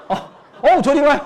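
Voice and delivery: loud, high, hammed-up protest; mock overwhelmed flattery. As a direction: shoo the customer away in fake awe. Salesperson mock-overwhelmed by customer's beauty. (호들갑 떨며 밀어내는 척)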